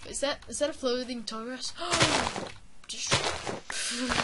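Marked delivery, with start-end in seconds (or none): 1.8-2.8 surprised gasp. Real, not acted. Surprised gasp at floating grass.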